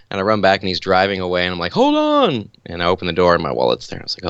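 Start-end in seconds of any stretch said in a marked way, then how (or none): 1.7-2.5 high desperate call; high frantic hold-on cry; calling after the car. Retold or imitated. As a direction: shout after a car pulling away. Calling after departing driver.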